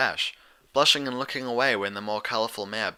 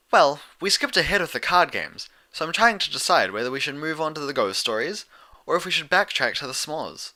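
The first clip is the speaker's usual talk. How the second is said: explaining; 5 semitones higher, 3 dB louder than usual. A narrator's reading. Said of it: "Twilight explained".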